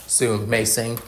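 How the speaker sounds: usual speaking voice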